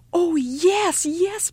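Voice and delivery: put-on high, eager child's cry; youngest child crying out. A narrator's reading. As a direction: jump at the offer like an excited child. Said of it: "Mother cried, youngest child".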